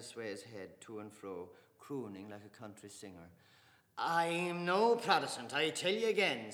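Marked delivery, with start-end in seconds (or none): 4.0-6.5 loud, high, slow insistence; insist, stung and flushing; flushed insistence. Acted. Mr Casey denying he is Protestant, flushed and loud.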